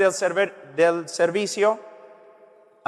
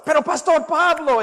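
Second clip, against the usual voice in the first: high, mock-pleading objection; protesting listener; 8 semitones higher, 4 dB louder than usual. Listener objecting to the preacher, in a high protesting voice. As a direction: pipe up in protest, much higher. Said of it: "estás pensando".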